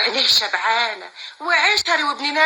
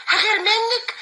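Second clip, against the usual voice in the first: mock disbelief; 9 semitones higher than usual. Aunt mocks the idea of jealousy.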